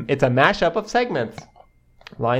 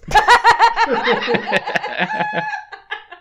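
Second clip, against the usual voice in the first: high, loud peal of laughter; burst of laughter; 5 dB louder than usual. Real, not acted. Cracking up at the name Christine Pickles, high laughter. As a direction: crack up helplessly.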